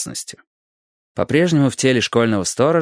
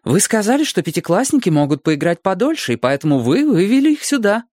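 higher, insistent retort; argumentative teacher; 7 semitones higher than usual. A narrator's reading. Teacher arguing heatedly with the school director, insistent. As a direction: argue back, pitch climbing. Учительница спорит с директором.

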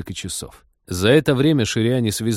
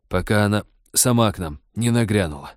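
ordinary delivery

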